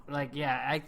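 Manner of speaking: usual speaking voice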